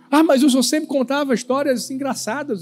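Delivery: put-on child's protest. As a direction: lightly mimic a child complaining to dad. Imitates daughter's protest.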